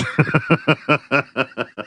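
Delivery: high giggling burst; real burst of laughter. Real, not acted. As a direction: lose it after the punchline. Breaks up after punchline.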